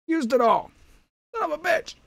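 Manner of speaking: high, loud put-on shriek; drunken ogre bellow. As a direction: shriek it like a cartoon goblin. Shouted frustration in high voice.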